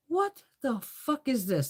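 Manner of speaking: high, drawn-out disbelief; incredulous, slow-motion reaction; bewildered. Retold or imitated. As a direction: stretch it out slowly, baffled and high. Bewildered reaction in retold story.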